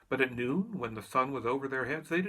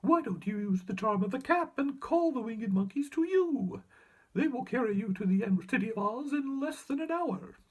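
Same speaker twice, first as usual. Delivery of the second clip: squeaky mouse queen; 10 semitones higher, 4 dB louder than usual. A narrator's reading.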